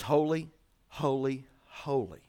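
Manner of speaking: low, soft, spaced-out holy, holy, holy; hushed reverence. Real, not acted. Preacher on God's only thrice-repeated attribute, hushed.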